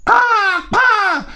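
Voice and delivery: high loud cawing; crow caw. Acted. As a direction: squawk like a crow. Crow calls.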